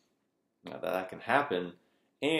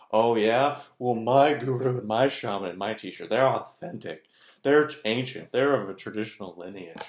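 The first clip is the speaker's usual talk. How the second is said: loud, smug one-upping brag; mock spiritual boast; 3 semitones higher, 7 dB louder than usual. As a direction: top the rival's guru with pride. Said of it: "the same mechanics".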